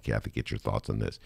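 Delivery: normal talking voice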